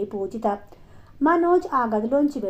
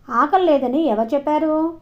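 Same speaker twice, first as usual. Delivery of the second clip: louder, higher, stern question; narrated stern challenge; serious demand; 5 semitones higher, 5 dB louder than usual. A narrator's reading. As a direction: demand to know who said it. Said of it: "సీరియస్గా అడిగింది పూజిత".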